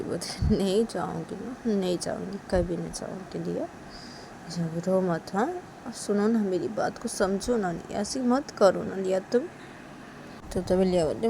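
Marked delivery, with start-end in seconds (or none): 0.6-9.4 soft, hushed reassurance; calm a crying child gently and quietly; soothing mother. Acted. Mother promises Liya she will not go, soft and soothing.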